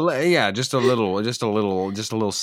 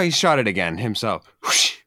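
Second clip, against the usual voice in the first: ordinary talk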